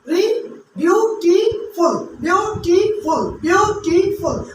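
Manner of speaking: beautiful stretched slowly, beats marked; slow beautiful, tap each syllable. Shown as a demonstration. Teacher counting syllables for the class, slow and clear. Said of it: "How many syllables are there?".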